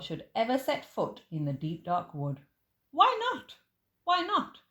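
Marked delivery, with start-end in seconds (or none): 2.9-4.6 put-on high, loud child's pleading; a little one begging to know why; squeaky insistent kid voice; eager child. Child pleading with Gruffalo dad.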